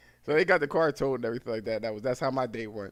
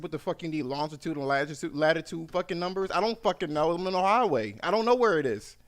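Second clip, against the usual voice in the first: building angry rant; 3 semitones higher than usual. Retold or imitated. Stranded driver yelling at the operator, building in anger. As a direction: let the anger climb line by line. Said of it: "I said".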